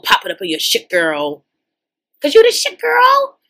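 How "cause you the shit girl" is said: much higher, squealing praise; hyped praise. Real, not acted. Praising the contestant's hair, a high squealing gush. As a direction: gush up high like a proud friend.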